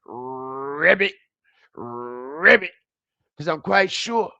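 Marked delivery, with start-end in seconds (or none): 0.1-2.7 deep croaking ribbit, throat puffed out; low taunting frog croak; mock frog croak. Shown as a demonstration. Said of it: "Expand your throat".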